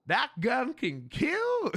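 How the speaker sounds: slow, high dopey American; caricatured bewildered American; mock American disbelief. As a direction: ask it slowly in a put-on American accent. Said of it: "And they're like".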